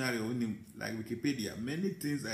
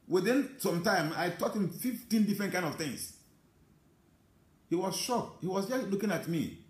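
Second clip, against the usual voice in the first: higher-pitched, proud retelling; excited; 6 semitones higher than usual. Real, not acted. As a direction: raise the voice as the story peaks. Proudly retelling his teaching feat.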